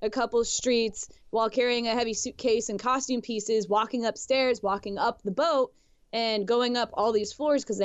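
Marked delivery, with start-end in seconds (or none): none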